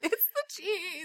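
soft, high, slow mock wail; distraught whimper. Wife explains meltdown to husband, mock tearful whimper. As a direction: whimper it like a worn-out mom.

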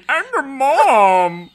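high, slow, loud cartoon alien; Marvin the Martian. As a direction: ham up a Martian voice. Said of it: "that was a horrible Marvin".

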